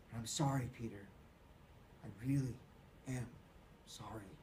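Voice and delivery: fading to a whisper; sorry softly dissolving to nothing; tearful apology. Acted. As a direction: let it die to a broken whisper.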